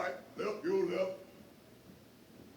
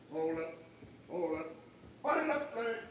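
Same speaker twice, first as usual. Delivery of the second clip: normal talking voice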